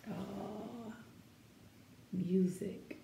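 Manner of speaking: soft whispered word. Real, not acted. Teacher pointing out music in the picture, whispered. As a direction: breathe it in awe. Hushed comment on the page.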